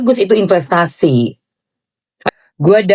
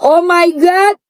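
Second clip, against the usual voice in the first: excited shriek; 8 semitones higher, slower than usual. Real, not acted. Shrieks at bag.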